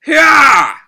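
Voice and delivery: loud, high drawn-out yell; full-force attacking shout; battle cry. Acted. Fighter forcing a bigger attack, yelling.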